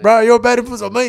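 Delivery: loud, indignant retort; loud protest. Retold or imitated. Answering the bad influence accusation, loud protest. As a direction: throw the blame back loudly.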